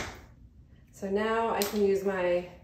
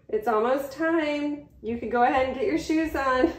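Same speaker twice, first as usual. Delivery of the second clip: yelling across the room; 6 semitones higher, 5 dB louder than usual. Real, not acted. Answering son calling from downstairs.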